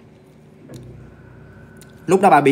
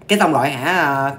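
usual speaking voice

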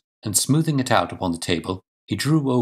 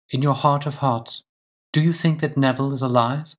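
a hint of an anxious wife, higher pitch; slightly raised voice, earnest questioning; lightly voiced searching lady; 6 semitones higher than usual. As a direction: lightly play a wife pressing for truth.

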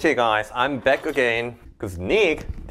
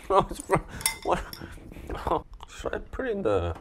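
ordinary talk, nothing marked

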